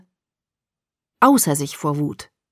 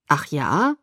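skeptical; 3 semitones lower than usual. A narrator's reading. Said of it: "erwiderte er skeptisch".

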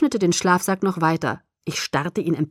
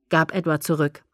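plain reading voice

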